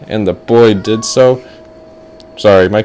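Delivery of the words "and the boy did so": plain reading voice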